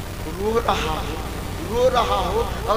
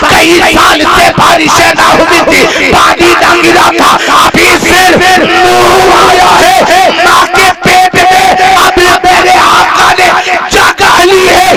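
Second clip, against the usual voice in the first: loud, slightly higher fervent sermon; full-force preaching; 5 semitones higher, 13 dB louder than usual. Acted. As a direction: proclaim the miracle to the crowd. (पूरे जोश से ऊँची आवाज़ में तक़रीर)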